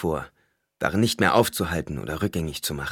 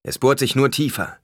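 higher, louder warning call; 7 semitones higher, 5 dB louder than usual. A narrator's reading. Paul warns the people around him it is getting worse, warning. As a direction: warn the others urgently. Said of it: "warnte Paul".

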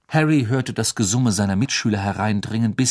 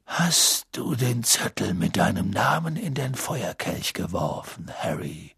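calm, soft, quiet question; 3 dB quieter than usual. Acted. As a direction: ask gently and softly. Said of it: "fragte Dumbledore ruhig".

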